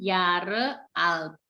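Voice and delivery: "Jahre" slowly, first A held long, H silent; long vowel. Shown as a demonstration. Teacher explaining silent H to learner, drawn-out A in "Jahre". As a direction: draw out the A in "Jahre". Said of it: "dibaca sedikit panjang".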